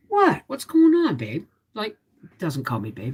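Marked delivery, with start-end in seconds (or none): 0.1-1.4 husband's concerned question, pitched way up; imitating husband. Wife quoting her husband checking on her, in a high mock voice. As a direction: mimic him asking what's wrong, much higher. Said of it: "he come upstairs and he goes".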